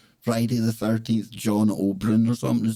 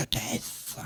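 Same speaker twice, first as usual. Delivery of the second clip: spooky whisper; 11 dB quieter than usual. Acted. Spooky word after number four.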